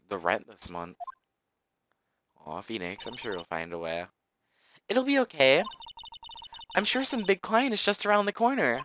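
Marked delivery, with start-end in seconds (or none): 4.9-8.8 put-on much higher, cheerful girl voice. Maya reassures Phoenix about rent, high voice. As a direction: reassure him in a high girlish voice.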